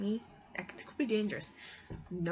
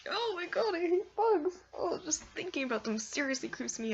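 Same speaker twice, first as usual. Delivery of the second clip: whiny disgust; 11 semitones higher than usual. Real, not acted. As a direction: wail it like the thought makes your skin crawl. Hates bugs.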